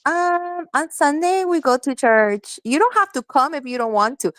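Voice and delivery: high-pitched mock mom voice; high, put-on guilt-trip voice; imitated manipulative mom. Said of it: "my mom was like".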